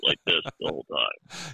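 deep voice. Shown as a demonstration. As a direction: put on a deep disguise voice. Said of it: "we'll talk in a deep voice".

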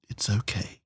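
soft, gentle reassurance; soothing. Acted. Chairwoman strokes the hostess's hair, soothing.

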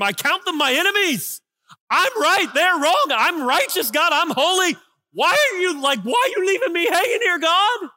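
builds from brisk to loud, high indignation; voicing David defending himself to God; self-righteous protest. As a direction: climb to a wounded yell. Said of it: "now he goes to defending himself".

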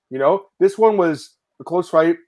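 ordinary delivery

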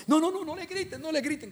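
high-pitched tearful plea, re-enacted; compassionate pleading. Preacher begs the crowd shouting at the woman, pleading. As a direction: beg them in a high, pained voice. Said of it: "yo sentí una compasión".

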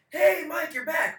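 bright mock barman hello; cheery greeting. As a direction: greet a regular like an old friend. Imagined staff greeting him.

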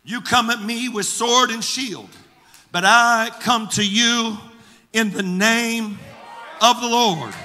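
defiant retort building to a shout; shouting back. Retold or imitated. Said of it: "David shouts back at him".

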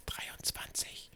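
slow, eerie whisper. Pretend. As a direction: breathe the number like a dark secret. Ominous number, then a gasp.

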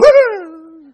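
a burst of loud, high laughter; loud incredulous laugh. Real, not acted. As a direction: laugh out at how absurd it was. Laughing at his own weeping.

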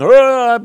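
loud, high wordless holler; hollered vocal noise, imitating open-air preaching; preacher's holler. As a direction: burst out with a preaching cry. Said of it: "I'm hollering".

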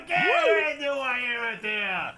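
high, loud soldiers' hooting shout; rowdy cheering. Acted. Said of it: "shouting out".

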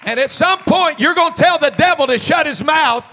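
rally the congregation with a shouted charge; full-force preaching. Real, not acted.

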